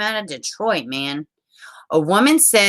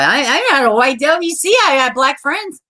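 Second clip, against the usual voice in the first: high, loud pleading defence; hammed-up indignant squeal, played for laughs; mock protest; 8 semitones higher, 4 dB louder than usual. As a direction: plead your case in a raised voice.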